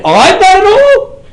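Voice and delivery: high, slow disbelieving question; hammed-up amazed echo; incredulous. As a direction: stretch out the disbelief. Incredulous retelling.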